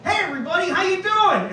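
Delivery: very high, squeaky-keen hello; eager stage greeting. Retold or imitated. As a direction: greet the crowd like an over-eager kid comic. Acts out his first greeting onstage.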